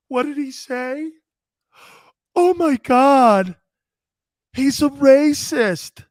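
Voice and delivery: high, loud, slow gasping outrage; mock outraged snowflake. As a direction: ham up a scandalized listener, drawn out. Mock outraged listener quote.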